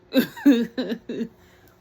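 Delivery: high loud burst of real laughter. Real, not acted. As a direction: break into a big laugh. Laughing at own joke.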